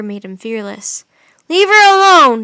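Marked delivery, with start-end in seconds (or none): 1.5-2.4 high, loud furious shout; angry shout. A narrator's reading. Jack defending Annie from the wolf, angry loud shout. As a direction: protect your sister with your voice. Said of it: "he shouted".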